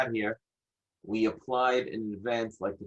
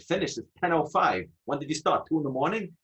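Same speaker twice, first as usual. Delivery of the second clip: high, louder disbelief at closing time; incredulous; 7 semitones higher, 4 dB louder than usual. Retold or imitated. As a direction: scoff at the clerk, amazed. Incredulous retort to clerk.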